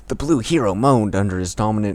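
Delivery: ordinary talk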